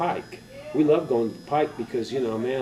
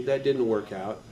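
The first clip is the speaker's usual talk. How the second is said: ordinary talk, nothing marked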